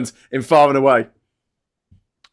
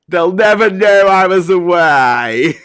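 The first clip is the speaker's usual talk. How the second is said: slow, raised, loud mock voice; imitating his friend; 5 dB louder, slower than usual. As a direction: draw it out, put-on and louder. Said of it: "He's like".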